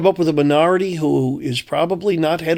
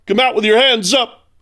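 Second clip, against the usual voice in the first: high, raised mock police call; mock bullhorn yell; 8 semitones higher than usual. Host mocking a politician's bullhorn yelling, raised and high. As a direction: ham up a cop yelling through a bullhorn. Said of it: "yelling into a bullhorn".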